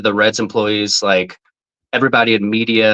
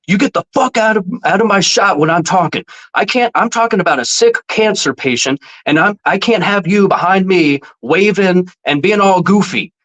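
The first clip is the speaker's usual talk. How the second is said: high, heated imitation of the reporter's tirade; retold angry rant; 9 semitones higher than usual. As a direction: play the furious reporter, pitched up. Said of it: "literally throwing F-bombs at me".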